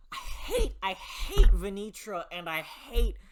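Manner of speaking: loud, high-pitched hateful outburst; angry rant. Real, not acted. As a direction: spit out your hate for them. Ranting about hated villains.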